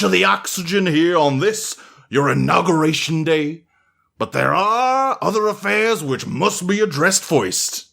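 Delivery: a lightly voiced stately old butler; grand, formal butler speech. The butler addresses the assembled masters, lightly voiced and stately.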